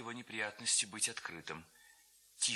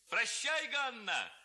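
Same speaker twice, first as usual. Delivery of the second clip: high, loud mocking farewell call; shouting lad; 13 semitones higher, 4 dB louder, slower than usual. A narrator's reading. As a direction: yell it out teasing. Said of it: "закричал". (парубок громко выкрикивает прощание)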